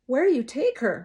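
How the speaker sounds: loud, high desperate plea; pleading mother. A narrator's reading. Mother pleads as sheriff takes daughter, loud. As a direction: beg him to answer. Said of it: "Mama pleads".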